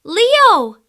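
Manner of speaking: loud, high startled cry; gasping call. Acted. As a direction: gasp his name in alarm. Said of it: "she gasped".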